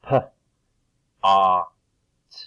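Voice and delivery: "P, A, T" as separate sounds of pot; segmented sounds. Shown as a demonstration. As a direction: puff "P", loud open "A", then "T". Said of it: "take apart the sounds".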